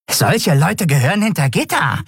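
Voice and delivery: high, put-on creature voice; bizarre skeleton. A narrator's reading. Skeleton ingratiates itself with lieutenant, high bizarre voice. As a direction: hasten to agree in a bizarre high voice.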